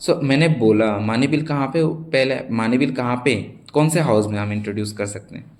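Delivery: usual speaking voice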